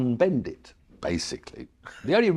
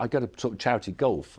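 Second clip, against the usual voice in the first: normal talking voice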